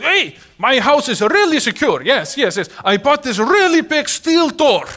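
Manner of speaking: high, loud, hammed-up bragging; imitating an over-confident homeowner; boastful homeowner. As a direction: boast about an impregnable house, mock-proud. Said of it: "can you imagine somebody telling you".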